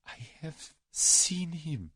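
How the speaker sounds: high, very slow Russian confession. A narrator's reading. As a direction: weigh each word fearfully. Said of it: "said the Russian slowly".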